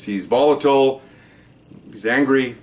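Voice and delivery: usual speaking voice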